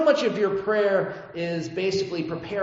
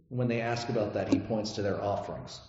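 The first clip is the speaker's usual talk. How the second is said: normal talking voice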